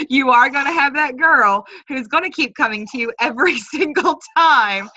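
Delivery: chuckling through the words; laughing. Real, not acted. Team leader laughing to her trainees about a clingy recruit. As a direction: laugh it out as you talk.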